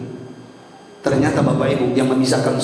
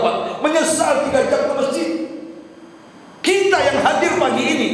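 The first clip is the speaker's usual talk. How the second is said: impassioned preaching; 11 semitones higher than usual. Real, not acted. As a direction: preach it out, pitched high. Preaches at raised pitch.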